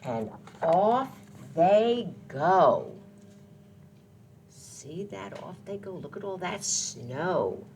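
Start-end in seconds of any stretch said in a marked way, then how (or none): none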